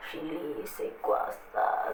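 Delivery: slow, drawn-out whisper; puzzled whisper. Acted. People wondering what Chile is, slow puzzled whisper. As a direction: whisper it slowly, as if mystified.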